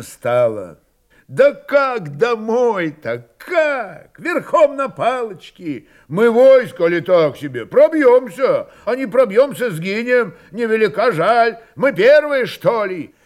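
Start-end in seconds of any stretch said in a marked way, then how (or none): 1.3-13.1 high, loud, exasperated challenges; heated crowd argument. A narrator's reading. Cossacks argue whether to fight through, heatedly. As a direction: throw questions back over the uproar. Crowd arguing loudly about going home.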